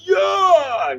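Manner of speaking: high, whooping mock cheer; crowd yell. Racer imitating fans rushing onto the course, whooping. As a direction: yell like an overexcited fan charging out.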